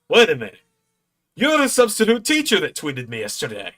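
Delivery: high, loud impression of sudden recognition; Joe Hendry. Wrestler recognises the substitute teacher, impersonated. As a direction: imitate a wrestler placing a face, very animated. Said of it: "not the best Joe Hendry impression".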